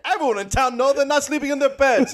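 high put-on accent sliding to Italian; hammed-up accent wandering into Italian; switching accents clumsily. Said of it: "What accent was that? ... went to Italian".

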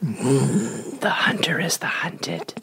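sinister hushed threat; slow menacing whisper. Pretend. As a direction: breathe it slowly like a barbarian warlord. Joking menacing letter voice-over.

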